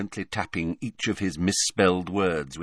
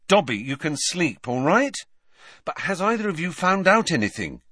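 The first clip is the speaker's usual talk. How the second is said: raised-pitch, hurried-on questioning; hastened question; 9 semitones higher than usual. A narrator's reading. Boy asking before his friend can intervene, pressing on in raised pitch. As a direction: move briskly on to the real question. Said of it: "he hastened to ask".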